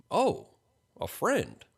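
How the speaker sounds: high, prying lilt; curious. A narrator's reading. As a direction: probe with perked-up curiosity.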